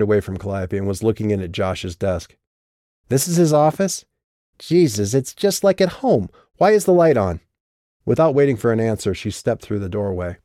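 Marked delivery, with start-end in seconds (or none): 3.1-7.4 Lauren voice, higher; raised, rising character voice. Acted.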